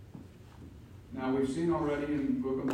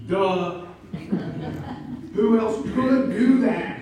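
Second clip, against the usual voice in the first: high, loud, slow sarcastic jab; loud sarcastic preacher's jab; mocking duh; 7 semitones higher, 10 dB louder, slower than usual. Real, not acted. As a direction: make it sound painfully obvious. Preacher mocking obvious point.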